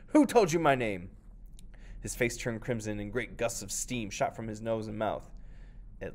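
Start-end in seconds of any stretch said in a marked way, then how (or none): 0.1-1.1 loud furious demand; raging. Acted. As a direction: shout in fury. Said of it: "the Oni raged".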